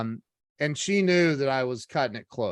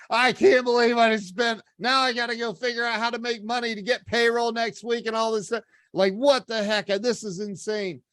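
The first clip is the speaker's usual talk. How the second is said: higher, agitated venting, retold; pissed off; 6 semitones higher than usual. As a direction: rant to yourself in a heated rush.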